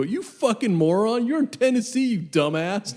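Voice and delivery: loud, high, exasperated mock yell; retold angry yelling. Buddy yells at his lost friend over the phone, imitated angry shout. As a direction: berate a clueless friend, imitated at high volume. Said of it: "I just hear him go".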